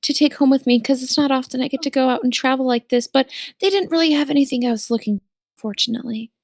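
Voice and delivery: sweet character voice. Acted. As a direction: play it sweet and earnest.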